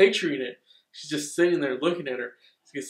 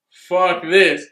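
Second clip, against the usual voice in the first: fed-up outburst; 3 semitones higher, 13 dB louder than usual. Retold or imitated. Girl decides to leave.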